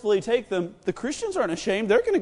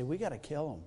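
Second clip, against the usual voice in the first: lower, much softer scheming; conspiratorial hush; 8 semitones lower, 14 dB quieter, faster than usual. Retold or imitated. Council plotting among themselves, hushed. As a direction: keep it low like a plot.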